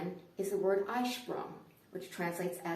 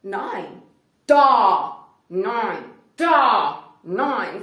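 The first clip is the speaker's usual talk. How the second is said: back-and-forth argument; 15 dB louder, much slower than usual. Retold or imitated. Cashier and customer deadlocked over money, shouting back and forth. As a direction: trade shouted one-word orders and refusals. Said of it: "I said".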